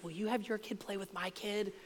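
very soft, conspiratorial mock parent; imitating a parent's discreet little favor; hushed sneaky request. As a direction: ask it on the quiet, as if behind the scenes. Parent's behind-the-scenes phone request.

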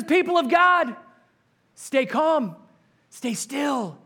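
high, loud, steady reassurance as Moses; a lightly voiced leader urging calm; Moses calming the people. Moses urges the panicked people to stay calm, loud and high. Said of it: "looks at them and says".

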